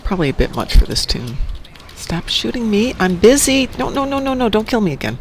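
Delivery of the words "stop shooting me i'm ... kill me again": higher-pitched protest at the game; half-playful begging to be left alone; mock pleading. Protesting as enemies shoot the player.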